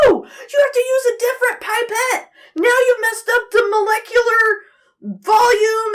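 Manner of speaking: high, loud, whiny mock shout; high, wailing imitated yell; teacher freaking out. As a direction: mimic the teacher melting down in front of the class. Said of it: "freaking out".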